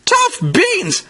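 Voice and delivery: very high, short outburst; child's yell. A narrator's reading. Casey yelling back at her mother, high-pitched. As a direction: squeal it back as a defiant kid. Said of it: "Casey yelled".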